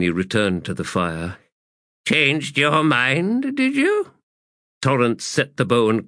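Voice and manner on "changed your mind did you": slow teasing crone voice; mocking old woman. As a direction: drag it out, mocking him. Olar Ethel mocking.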